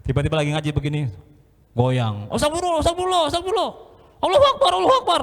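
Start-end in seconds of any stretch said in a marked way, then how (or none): 2.3-5.2 high, frantic cries of astagfirullah and Allahu Akbar; panicked praying. Retold or imitated. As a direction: cry out to God in sudden terror. Panik zikir saat gempa. (jamaah panik berzikir saat gempa, berseru tinggi dan panik)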